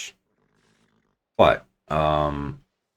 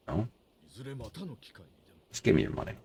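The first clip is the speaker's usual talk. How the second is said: normal talking voice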